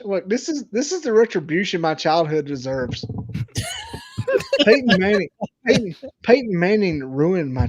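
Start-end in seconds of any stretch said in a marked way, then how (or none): 3.1-4.6 shrieking cackle; helpless shrieking laugh; high shrieking laugh. Real, not acted. Cackling at his own rant.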